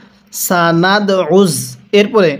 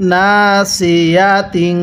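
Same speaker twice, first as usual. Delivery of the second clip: slow drawn-out recitation; much slower than usual. Shown as a demonstration. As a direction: stretch each syllable slowly. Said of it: "কিভাবে শিখব?". (ধীরে টেনে তিলাওয়াত)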